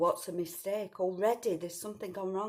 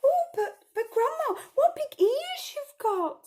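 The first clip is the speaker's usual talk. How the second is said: put-on much higher, louder slow frightened girl; nervous child; 6 dB louder, slower than usual. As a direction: squeak it, starting to worry.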